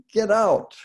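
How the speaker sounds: loud, high shout of anger; retold angry yell. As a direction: yell it to throw someone out. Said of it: "I yelled at him".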